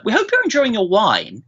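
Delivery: a hint of a chirpy sales lady; imitating the wine lady. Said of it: "a lady from the wine company who says".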